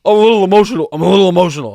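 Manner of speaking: loud, choked-up mock apology; imitated tearful dad. Dad apologizing to son for getting emotional, imitated tearfulness. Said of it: "Are you crying right now?".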